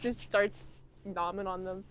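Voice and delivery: normal talking voice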